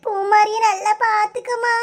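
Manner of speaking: sobbing, high-pitched tearful voice; crying. Acted. Bride leaving her parents for the wedding, crying. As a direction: plead through sobs, voice pitched high. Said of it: "ஏட்டி, அழாத".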